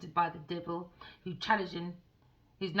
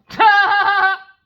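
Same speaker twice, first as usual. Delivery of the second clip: loud cackle; 12 semitones higher, 18 dB louder than usual. Real, not acted. Loud burst after the joke.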